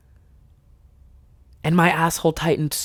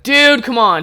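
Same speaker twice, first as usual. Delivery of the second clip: mock protest; 7 semitones higher, 5 dB louder than usual. Acted protest at friend's dark joke.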